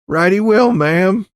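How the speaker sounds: put-on high, loud, excitable country boy; breathless Johnny. A narrator's reading. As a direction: blurt it out, still worked up. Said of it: "still breathing fast".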